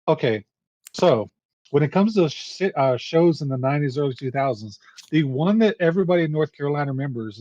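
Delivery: ordinary delivery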